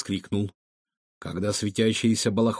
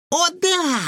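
put-on very high, drawn-out girlish cry; excited exclamation; 4 dB louder than usual. A narrator's reading. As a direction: exclaim with glee, high-pitched. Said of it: "воскликнула Лиза".

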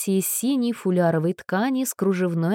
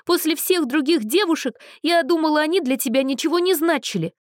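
high, whiny, tearful; whimpering; 9 semitones higher than usual. Acted. Abandoned girl whimpers at Anna, high and tearful. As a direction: whimper it like a hurt child.

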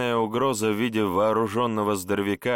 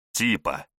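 cold reply; 3 semitones lower than usual. A narrator's reading. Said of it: "холодно отозвался я".

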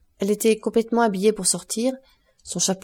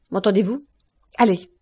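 mildly raised stern voice; brusque, understated anger; rough order; 4 dB louder than usual. A narrator's reading. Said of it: "cria monsieur de Villefort si rudement".

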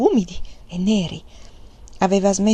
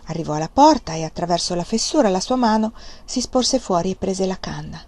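plain reading voice

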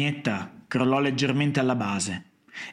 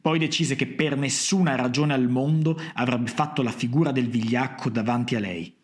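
plain reading voice

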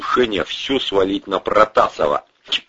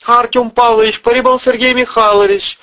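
very high, loud, monotone announcement; impassive automaton secretary; 7 dB louder than usual. A narrator's reading. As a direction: announce the visitor like a machine. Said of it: "Безучастным голосом автомата".

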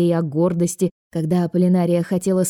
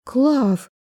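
higher-pitched surprised call; 6 semitones higher than usual. A narrator's reading. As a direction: say the name in mild astonishment. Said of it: "удивилась Аполлинария". (удивлённо)

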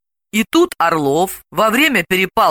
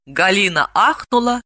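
ordinary delivery, nothing marked

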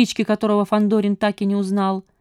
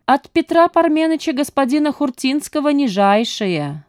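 sly knowing wink; 7 semitones higher than usual. A narrator's reading. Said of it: "подмигнул маленькому".

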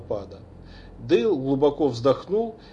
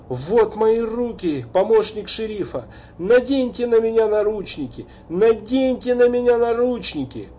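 high, loud anguished begging; desperate pleading; 8 semitones higher, 4 dB louder than usual. A narrator's reading. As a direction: beg to be arrested. Kneeling father begs for handcuffs. (отчаянная мольба)

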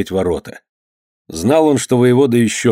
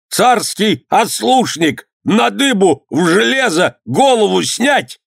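high, shrieked threats; shouting; 10 semitones higher, slower than usual. A narrator's reading. Held governor threatening punishment, high-pitched shouting. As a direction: squeal out each threat, outraged. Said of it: "кричал воевода".

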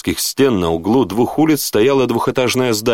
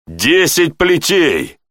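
slow, higher-pitched menace; hissed threat; 8 semitones higher, much slower than usual. A narrator's reading. Lieutenant sentences the guard to lashes, threatening. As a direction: draw out the sentence with contained anger. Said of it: "прошипел Тьерри".

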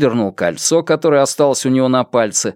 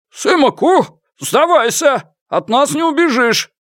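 high, commanding calls through a horn; shouting. A narrator's reading. Security officer demanding the fugitive's surrender, high shouting. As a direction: shout high in separate bursts toward someone far off. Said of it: "Кричал он довольно долго".

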